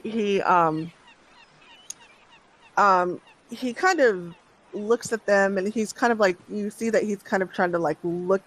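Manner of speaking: ordinary talk, nothing marked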